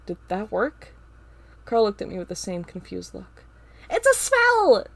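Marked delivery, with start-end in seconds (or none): 3.9-4.9 high, loud alarmed cry; scream. A narrator's reading. Carl screams the warning, loud cry. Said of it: "Carl screams".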